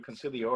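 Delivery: "Consigliere" echoed low and soft, as con-sil-ee-OR; imitated Italian pronunciation. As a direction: repeat "Consigliere" his way, dropped low. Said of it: "you said it in Italian".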